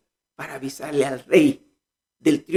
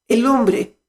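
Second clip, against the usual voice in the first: normal talking voice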